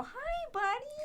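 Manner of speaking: high, soft greeting to a puppy. Retold or imitated. Pulling over to greet a loose puppy, high coaxing voice. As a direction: coax a puppy in a high little voice.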